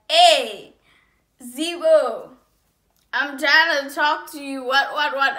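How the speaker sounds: loud hollering call; imitated screaming. Guy comes out of nowhere calling her, imitated shouting. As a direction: holler it out, imitating an insistent man. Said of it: "he's screaming".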